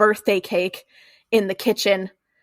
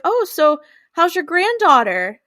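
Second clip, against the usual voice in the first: lightly voiced neighbor, pitched up; neighborly small talk; 8 semitones higher than usual. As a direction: imitate a chatty neighbor's higher, casual question.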